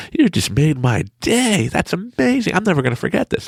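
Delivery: high, stretched-out, thrilled thanks; elated gushing. Retold or imitated. Passenger thanking the funny friends, high and elated. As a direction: gush with amazed delight. Passenger gushes about their day.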